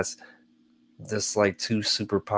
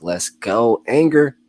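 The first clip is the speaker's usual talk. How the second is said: pumped-up call, louder and higher; rally yourself like a hype man; hyped inner voice; 4 semitones higher, 9 dB louder, slower than usual. Retold or imitated. Subconscious cheering on anger, loud and high. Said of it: "The subconscious hitting me quick, like".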